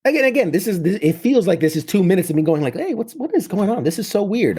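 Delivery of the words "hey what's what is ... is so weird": high-pitched puzzled voice, pitch jumping up; baffled, voice climbing high; bewildered. Retold or imitated. Baffled by his dangling arm.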